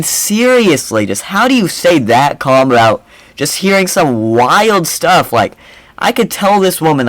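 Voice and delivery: ordinary delivery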